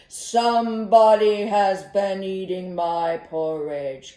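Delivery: loud, booming papa bear; big booming bear voice; great big voice. Acted. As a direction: boom it out like a huge bear. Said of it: "with his great big voice".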